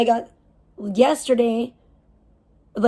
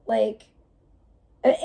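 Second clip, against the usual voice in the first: ordinary delivery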